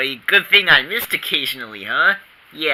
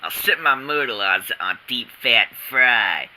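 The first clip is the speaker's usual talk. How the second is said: ordinary talk, nothing marked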